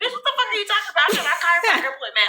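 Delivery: high, very fast, laughing through the words; laughing, confused. Retold or imitated. Friend laughing at the accusation on the phone, fast and laughing. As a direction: blurt it out laughing in disbelief. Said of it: "And she just like".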